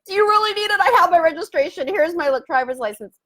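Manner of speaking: hurried fluster at the officer; flustered plea. Retold or imitated. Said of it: "I'm like".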